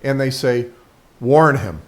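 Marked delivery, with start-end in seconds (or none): 1.2-1.8 raised, clearly spoken command; loud and clear. Acted. Dog owner alerting the dog on a walk, loud and clear. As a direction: call the cue out firmly and clearly. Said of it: "loud and clear".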